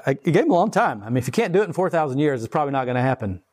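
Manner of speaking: usual speaking voice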